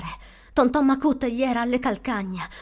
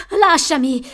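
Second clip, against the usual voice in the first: loud, higher-pitched cry to be released; boy's yell; 4 semitones higher, 8 dB louder, slower than usual. A narrator's reading. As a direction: shout it like a struggling, panicked child. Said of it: "urlò Joby".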